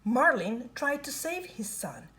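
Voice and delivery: ordinary delivery, nothing marked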